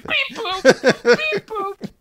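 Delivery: high laughing beep-boop robot; toy robot voice. Pretend. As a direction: squeak out beeps through laughter. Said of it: "There's more beeps and boops".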